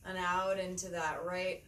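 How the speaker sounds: unhurried meditation guide, long pauses between phrases; measured, spacious yoga-teacher delivery; guided meditation. Acted. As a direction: lead them gently through the breath, phrase by phrase.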